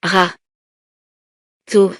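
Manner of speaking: "Ra. To." split into two syllables with a long pause between; syllabe par syllabe. Shown as a demonstration. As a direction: say "Ra", stop, then "To". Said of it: "Je frappe les syllabes". («Ra. To.» découpé en syllabes bien séparées)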